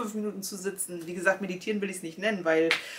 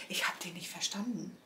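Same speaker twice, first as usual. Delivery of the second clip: whispered aside; 10 dB quieter than usual. Real, not acted. Telling viewers she couldn't understand him, whispered.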